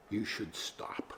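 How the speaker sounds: hushed, slow whisper of the wife's request. Retold or imitated. Innkeeper passes on the wife's answer in a whisper. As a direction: breathe it out like a secret.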